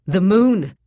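loud, high, sudden outburst; wild. A narrator's reading. Bound captive guessing why he was tied, wild outburst. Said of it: "he said wildly".

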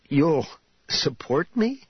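"support" picked out, ending in a rising, questioning "me"; surprised stress on support. A narrator's reading. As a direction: query the word "support" in mild disbelief. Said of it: "emphasis on the word support".

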